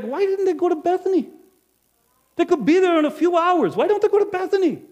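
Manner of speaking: high, loud frustrated complaint; exasperated. Acted. Innkeeper fumes to himself about Joseph and Mary, loud and exasperated. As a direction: throw up your hands at them.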